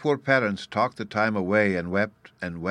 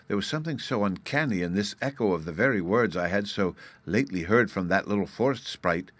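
usual speaking voice

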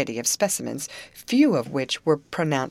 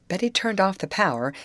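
ordinary delivery

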